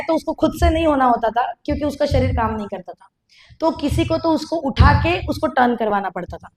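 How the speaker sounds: ordinary talk, nothing marked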